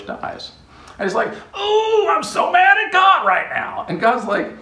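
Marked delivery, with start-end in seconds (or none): 1.5-3.9 mock angry whine. Jonah sulking after the bush dies.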